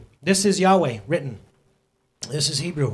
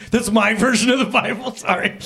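laughing; 7 semitones higher, 5 dB louder than usual. Real, not acted. Laughs at own retelling.

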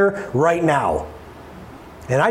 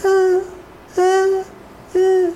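put-on child's frightened whimper; 12 semitones higher, much slower than usual. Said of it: "and I hear".

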